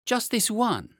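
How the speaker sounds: put-on high, loud boyish plea; eager cry. A narrator's reading. Lionel grabbing the forbidden book, high eager cry. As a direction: cry out high like a keen child. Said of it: "cried Lionel".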